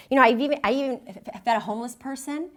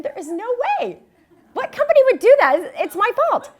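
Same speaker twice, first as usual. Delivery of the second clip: laughing disbelief; 12 semitones higher, 9 dB louder than usual. Retold or imitated. Laughing at own predicament.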